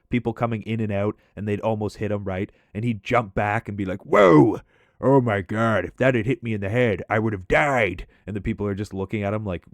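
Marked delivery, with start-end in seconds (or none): 4.1-8.1 mock fright of a near head hit; startled Whoa. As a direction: jump back and yelp. Imitating Mike startled.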